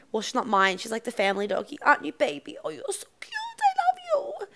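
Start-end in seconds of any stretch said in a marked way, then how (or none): 1.8-4.5 much higher, cooing pet voice. Real, not acted. Cooing to her dog.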